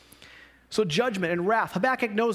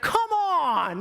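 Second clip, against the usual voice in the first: exasperated cry, pitched way up; angry outburst; 10 semitones higher, 4 dB louder than usual. Acted. Preacher acts out Jesus furious at the people, angry outburst. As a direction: burst out in acted anger, high and drawn. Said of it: "It's like he's angry".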